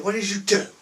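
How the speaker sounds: high, loud, outraged demand; furious boss yelling at idiots; angry dealer. Retold or imitated. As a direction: tear into the chavs. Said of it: "they could be swearing".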